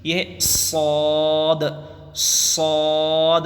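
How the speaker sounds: صاد held long, heavy and full; teacher chanting a heavy صاد; full-mouthed letter. Shown as a demonstration. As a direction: fill the mouth and draw out صاد. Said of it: "منہ بھر کے پڑھنا چاہیے".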